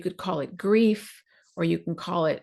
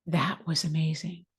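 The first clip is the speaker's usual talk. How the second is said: hushed, breathless "That was amazing."; awed whisper; 4 semitones lower, 5 dB quieter than usual. Retold or imitated. Recalling the blessing, an awed whisper. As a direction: whisper it in quiet awe.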